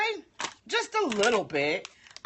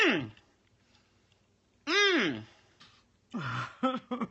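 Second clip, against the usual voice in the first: mouth full; much slower than usual. Real, not acted. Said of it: "talking with my mouth full".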